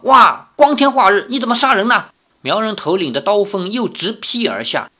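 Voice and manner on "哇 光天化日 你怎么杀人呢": loud, higher startled yell; alarmed cry. A narrator's reading. Hero dodging a sword cuts and yells at the attacker. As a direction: cry out as the blade swings. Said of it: "叫道".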